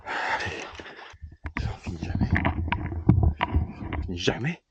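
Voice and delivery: breathless, whispered self-encouragement up a steep climb; pushing through exhaustion, half-whispered; out of breath. Real, not acted. (essoufflé, chuchoté, à bout de souffle)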